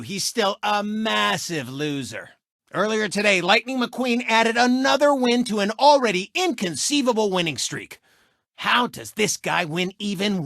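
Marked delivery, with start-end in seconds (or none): none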